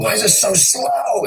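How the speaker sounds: high, slow whiny complaint; mock whining at a slow phone; mock whine.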